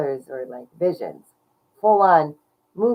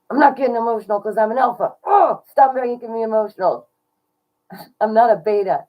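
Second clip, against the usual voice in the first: loud, fast, higher, laughing bravado; mock tough denial; 5 semitones higher, 7 dB louder than usual. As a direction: play tough alpha while choking up. Jokingly denies getting emotional.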